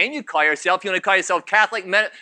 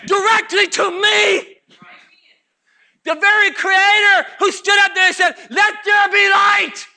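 higher, building preacher's shout; full-force preaching; 11 semitones higher, 5 dB louder than usual. Acted. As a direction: build the warning to a high cry.

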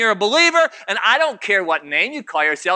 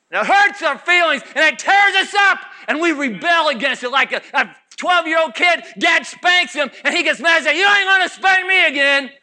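higher, building preacher's shout; full-force preaching; 7 semitones higher, 3 dB louder than usual. Acted. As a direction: build the warning to a high cry.

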